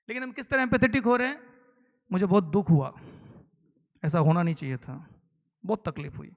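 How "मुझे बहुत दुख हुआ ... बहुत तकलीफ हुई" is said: empty sympathy. Shown as a demonstration. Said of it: "लेकिन चेहरे से दुख दिख नहीं रहा है".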